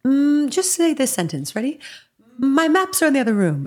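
breezy teacher calmly asking her to repeat; imitating her therapist. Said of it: "And he said it like it was just a very common sentence".

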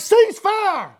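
high, urgent retold shout; hollered battlefield command. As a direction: holler it like a soldier halting gunfire. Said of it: "started hollering out".

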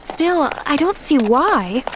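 high, slow, drawn-out protest; insistent. A narrator's reading. Girl maintains her view to the doctor, insistent. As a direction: hold your point, stretching the words. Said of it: "maintained Pollyanna".

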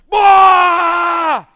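long, very loud high yell; huge fighter's roar. Retold or imitated. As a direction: let out an all-out battle cry. Imitates fighter's huge final yell.

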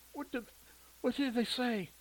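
breathless, halting question; out of breath. Acted. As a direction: gasp it out between breaths. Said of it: "trying to catch her breath".

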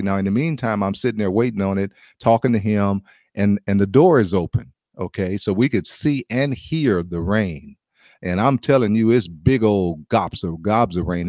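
ordinary delivery

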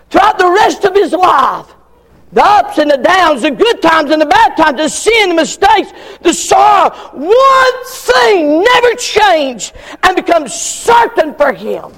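slowing into a shouted peak; preaching at full force. Acted. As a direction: climb to the climax, each phrase hammered. Preacher building to a climax.

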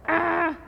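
high, held mock "aah"; bocinazo de respuesta imitado. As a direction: honk back with your voice. Imita responder con otro bocinazo. ("aah" agudo imitando una bocina)

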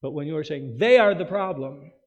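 normal talking voice

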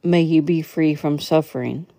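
ordinary talk, nothing marked

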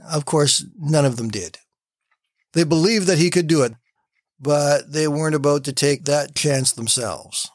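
ordinary delivery

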